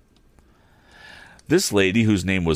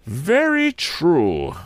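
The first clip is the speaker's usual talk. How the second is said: higher, very slow grave pronouncement; grave and emphatic; 9 semitones higher, much slower than usual. Acted. Silent lady agreeing, grave, emphatic and very slow. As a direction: weigh each word gravely. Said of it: "said gravely and emphatically".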